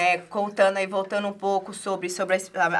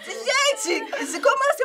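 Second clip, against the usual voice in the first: shocked disbelief. Retold or imitated. Retold shock at the news.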